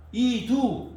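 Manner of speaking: 이두 said high and loud; Greek word. Shown as a demonstration. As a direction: call out 이두 as a sharp interjection. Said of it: "이런 말이 있어요".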